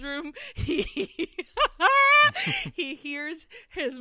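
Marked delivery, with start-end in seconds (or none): 1.4-2.7 high, loud burst of laughter; real laughing fit. Real, not acted. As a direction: crack up loudly at your own slip. Cracks up over own slip.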